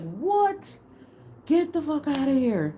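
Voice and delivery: a high, stunned "What?" then disbelief; reliving the moment she heard the news; retold disbelief. Recalling hearing of the Pentagon attack, stunned disbelief. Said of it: "And I'm like".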